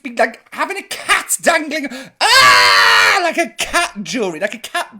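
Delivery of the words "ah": sudden loud yelp of pain as the cat bites; pained scream. Retold or imitated. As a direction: cry out as teeth sink into your wrist. Cat bites wrist, she screams.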